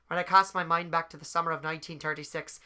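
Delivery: lightly lilting Irish accent. Shown as a demonstration. Podcaster showing the Irish accent from a college play, Irish accent. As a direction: recite it as an Irish stage narrator. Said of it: "I did a whole show with an Irish accent".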